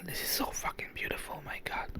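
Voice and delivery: hushed, breathless whisper of wonder; real awe in the dark; awed whisper. Real, not acted. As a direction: whisper it like you can't believe how beautiful it is.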